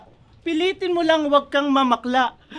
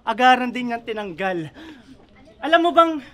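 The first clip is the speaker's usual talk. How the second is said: ordinary talk, nothing marked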